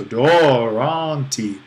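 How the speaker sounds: long, sung-out accents on both halves of "doron" before "ti"; pitch accents on both syllables. Shown as a demonstration. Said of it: "accented on both syllables".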